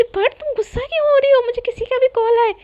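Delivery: high-pitched, pushing back; exasperated protest. Acted. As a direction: protest at unfair anger. Acted protest in a quarrel.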